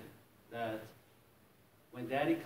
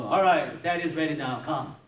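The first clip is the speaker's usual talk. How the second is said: calling out; 4 semitones higher, 10 dB louder than usual. Retold or imitated. Dad calling kids back.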